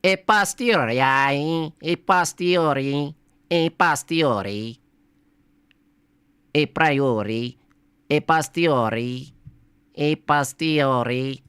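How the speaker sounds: put-on slow, hammy Italian accent on the Latin; stretched pasta-chef Italian accent; mock Italian accent. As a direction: say it like a stage Italian, drawn out. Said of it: "I'm just gonna do it in, in an Italian accent".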